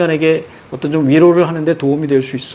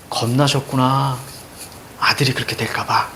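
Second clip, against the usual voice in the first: hushed, lowered, understanding tone; gentle consolation; 5 semitones lower, 7 dB quieter than usual. Shown as a demonstration. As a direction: say it softly to console. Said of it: "여기 위로로 가야 되는 거야".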